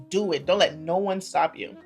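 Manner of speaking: ordinary talk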